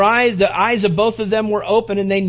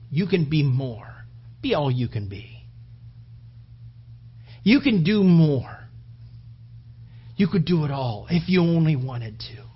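serpent's coaxing; 2 semitones lower than usual. Acted. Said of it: "the woman saying".

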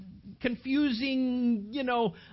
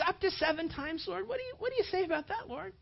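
quick, higher pleading Peter; Peter asking; 10 semitones higher, faster than usual. Acted. Peter pressing Jesus for a limit. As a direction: press Jesus eagerly, fast and up.

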